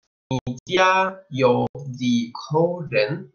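slow, careful Mandarin question; model Chinese question. Shown as a demonstration. Teacher reading the question slowly to Spanish-speaking students. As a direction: spell out each syllable for learners. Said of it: "si alguien nos pregunta".

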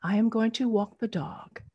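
whispered, fearful; a frightened hushed whisper; afraid whisper. Shown as a demonstration. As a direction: whisper it as if scared of being heard. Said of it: "As if you were afraid".

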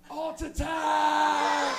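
very slow, loud drawn-out catchphrase; TV host catchphrase. Retold or imitated. Host announcing his show name to the camera, slow and loud. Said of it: "look at the camera and be like".

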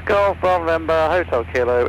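ordinary talk, nothing marked

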